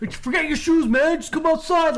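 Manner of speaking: high, loud urgent order; urgent, emphatic. Retold or imitated. Police officer orders resident outside, urgent and emphatic. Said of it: "super urgent, like, really emphatic".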